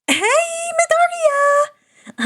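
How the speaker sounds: high, drawn-out call across the lab. Acted. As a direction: sing out his name from across the room. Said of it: "Hatsume called".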